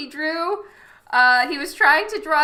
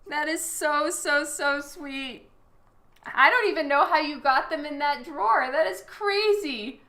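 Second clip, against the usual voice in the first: usual speaking voice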